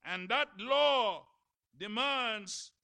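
loud, high, rising sermon cadence; preaching at full force. Acted. Preacher to his congregation, loud and fervent.

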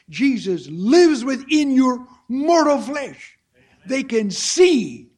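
loud, slow proclamation; full-force preaching. Acted. Preacher declaring to the congregation, loud and slow. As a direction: proclaim it to the back row. Climax of sermon point.

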